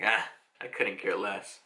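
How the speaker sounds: very low, soft villain voice; dismissive indifference. Acted. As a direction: brush it off quietly and low. Crocodile dismisses the question.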